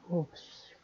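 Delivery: unvoiced little oops under the breath; whispered oops. Real, not acted. Teacher notices a writing slip, whispering oops to herself. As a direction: catch your own mistake in a whisper. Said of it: "slip while writing".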